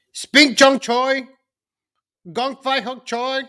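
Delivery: high, slow and loud mangled Spink Chung Choi; mocking the greeting with a garbled version. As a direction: belt out a garbled Gong Fai Huk Choi. Mocking garbled New Year greeting.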